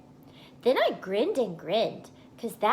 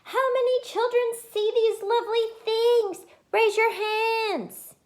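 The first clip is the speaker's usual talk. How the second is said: high, loud call across the playground; hollered; 7 dB louder than usual. Acted. Junie hollering to the children on the playground, high and loud. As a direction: holler it out like a game show host. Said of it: "I hollered".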